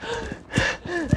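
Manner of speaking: high, soft exaggerated gasp; mock gasp; imitated gasp. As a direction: mimic someone gasping in a fluster. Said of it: "He goes".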